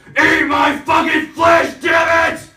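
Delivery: high, loud, slow shout; God shouting. Retold or imitated. God yelling at Catholics, a slow high roar. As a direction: roar it slowly like a crazed deity.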